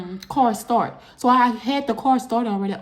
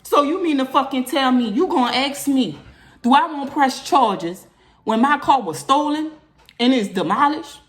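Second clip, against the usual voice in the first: indignant retort, louder and higher; furious; 5 semitones higher, 4 dB louder than usual. Retold or imitated. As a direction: fire the question back in outrage. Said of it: "I'm all furious".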